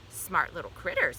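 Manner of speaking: ordinary delivery, nothing marked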